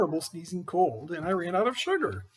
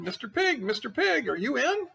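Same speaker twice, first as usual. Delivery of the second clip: calling out; 8 semitones higher than usual. Acted. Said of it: "I called".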